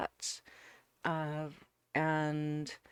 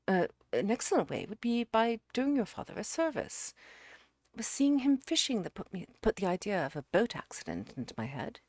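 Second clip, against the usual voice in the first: higher, louder, animated self-justification; earnest suitor confessing; 6 semitones higher, 7 dB louder than usual. Acted. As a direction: explain the scheme emphatically, in character. Narrator voices Jerry's confession.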